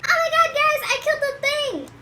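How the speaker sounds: high, loud excited squeal of Richie; imitating Richie. Richie celebrating to his friends, high and loud. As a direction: gush it out way up high like a thrilled kid. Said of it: "he's like".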